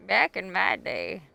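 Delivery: a hint of a grumpy old-timer; mock old-timer. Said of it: "No, I'm kidding".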